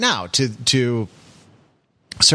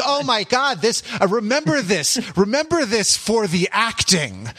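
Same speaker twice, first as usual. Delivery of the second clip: excited; 8 semitones higher than usual. Retold or imitated. Retelling own excited inner thought.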